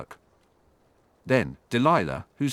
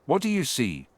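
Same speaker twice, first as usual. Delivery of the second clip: mildly angry, higher-pitched demand; understated anger, pitch raised; angry questioning; 5 semitones higher than usual. A narrator's reading. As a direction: demand answers with a touch of anger. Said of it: "he asked angrily".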